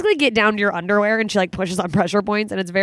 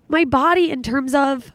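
ordinary delivery

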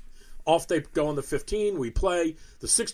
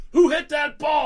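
imitating a furious yell, high and loud; mock angry shout; 10 semitones higher, 7 dB louder than usual. Bill demanding who hit into his group, mock angry shout. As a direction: bark it out like an angry ballplayer. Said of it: "Bill was so mad when you said that".

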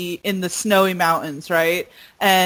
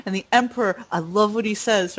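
usual speaking voice

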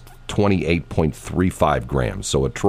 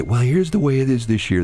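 sports geek friend; 8 semitones higher than usual. Retold or imitated. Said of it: "they'd say".

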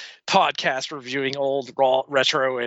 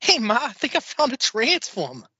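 Mark Wahlberg impression; 8 semitones higher than usual. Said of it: "Mark Wahlberg guy".

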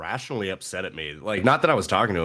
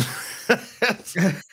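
high burst of laughter; 10 semitones higher than usual. Real, not acted. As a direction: crack up high. Laughing at own joke.

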